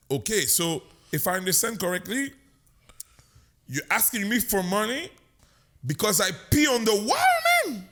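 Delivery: put-on English said in a broad Quebec French accent. To the armed guard, in a thick Quebec accent. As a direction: lay the Quebec accent on thick to win him over. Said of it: "Avec mon accent le plus québécois possible".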